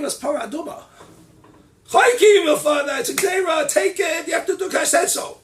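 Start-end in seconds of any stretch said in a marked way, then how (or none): 1.9-5.4 much higher, loud shouted decree; commanding shout. Acted. Father ordering the child to accept, shouting.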